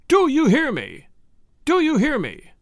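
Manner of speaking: high, exasperated repeated demand; wild with rage. A narrator's reading. Husband shaking his wife with all his might, enraged. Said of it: "He was wild with rage now".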